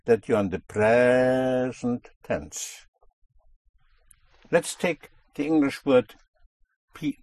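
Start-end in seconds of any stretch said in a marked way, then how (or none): 0.6-2.9 PRE in "present" drawn right out; pushed stress. Shown as a demonstration. Teacher showing students the signal of present tense, stress on PRE in "present". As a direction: lean long on the first syllable of "present". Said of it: "That push to Spanish ears will be the signal".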